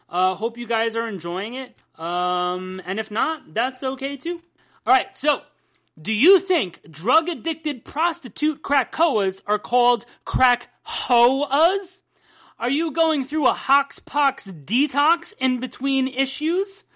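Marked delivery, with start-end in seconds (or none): 4.8-16.8 upbeat announcer read. Acted. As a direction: hype up the break like a radio host. Podcast host doing a commercial-style lead-in.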